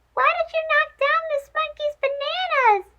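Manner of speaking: high, probing mouse voice; questioning mouse. Acted. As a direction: press the elephant for an answer. Mouse character voice.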